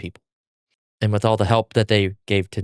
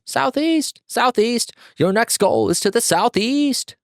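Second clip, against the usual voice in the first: high, squawking crow voice, drawn out slowly; crow's call; 14 semitones higher, slower than usual. Acted. Crow announcing the next mission to Tanjiro, high squawk. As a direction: caw the orders like a messenger bird. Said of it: "says Tanjiro's crow".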